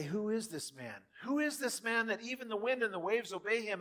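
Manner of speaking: rising amazed cry; stunned disciples asking; awed disbelief. Acted. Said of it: "And they say".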